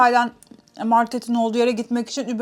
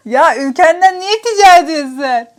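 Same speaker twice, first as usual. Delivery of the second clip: loud, higher mock-exasperated question; incredulous outburst; 5 semitones higher, 9 dB louder than usual. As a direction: retell it as a baffled, half-laughing protest. Şaşkın tepkisini gülerek canlandırıyor. (şaşkınlıkla, yüksek sesle sitem eder gibi)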